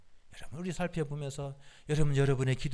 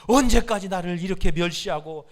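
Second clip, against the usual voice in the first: loud, preached rebuke in God's words; God's anguished reproach; 3 semitones higher, 11 dB louder than usual. Retold or imitated. Preacher quoting God to Israel, anguished reproach. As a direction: voice a pained divine complaint, forcefully. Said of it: "가슴을 치면서 십일 절에서 그렇게 말씀하시는".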